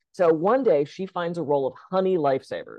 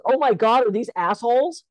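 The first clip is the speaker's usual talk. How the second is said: put-on shocked kid outburst; 7 semitones higher, 3 dB louder than usual. Joke punchline, kid shouting.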